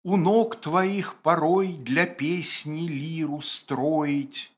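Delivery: медленно и нараспев; протяни строку как лирик; чтение стихов. Acted. Читает строку стиха. (декламация)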